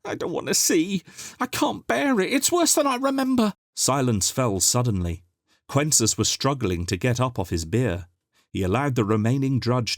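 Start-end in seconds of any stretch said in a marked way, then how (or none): 0.0-3.5 much higher frightened little voice; distressed. A narrator's reading. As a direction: recoil from what he sees. Arcue distressed.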